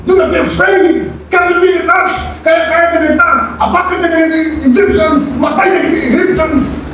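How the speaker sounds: high, bold battle cry; Moses' brave outward cry. Acted. Moses before the Israelites, defiant and high against the Egyptians. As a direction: shout defiance at the enemy. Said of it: "he was saying".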